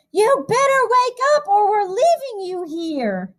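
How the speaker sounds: a sister nudging her brother awake; a hint of a girl urging him up; little sister's voice. A narrator's reading. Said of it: "spoke in my ear".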